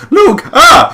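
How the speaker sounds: high shrieked ah in your face; mock jump scare. Imitating the wife's jump scare, a high shriek. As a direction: shriek it like a ghost popping up.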